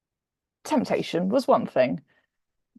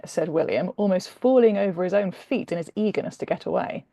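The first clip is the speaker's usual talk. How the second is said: ordinary delivery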